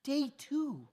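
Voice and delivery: high, squeaky mock frustration; exasperated. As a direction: whine it up high like you can't believe it. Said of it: "I'm so mad".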